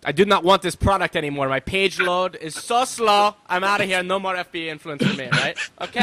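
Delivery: put-on Argentine accent, fed-up customer; grumbling buyer in an Argentine accent. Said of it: "Is that an Ar-, your Argentine voice?".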